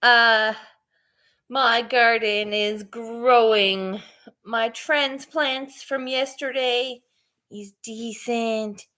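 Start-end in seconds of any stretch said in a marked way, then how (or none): 1.5-8.8 slow, drawn-out delivery. Real, not acted. Slowing down for viewers thrown by the accent, deliberately slow. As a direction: take it slowly so they can follow. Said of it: "I'll slow it down for ya".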